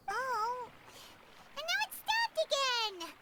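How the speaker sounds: normal talking voice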